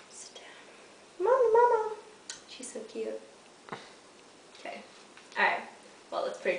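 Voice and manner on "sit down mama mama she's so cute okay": whispered command, much softer. Real, not acted. Owner whispering to her dog to sit. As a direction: breathe it to the dog.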